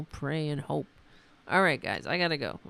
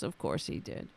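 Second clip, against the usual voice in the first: lower, unvoiced dry mutter; whispered sarcastic aside; 10 semitones lower, 10 dB quieter than usual. Real, not acted. Sneering quietly at the congressman's theft. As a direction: toss it off under your breath.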